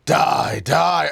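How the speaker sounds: high, loud villain battle cry; Reaper. Acted. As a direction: shout it like a game villain mid-attack. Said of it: "From Overwatch".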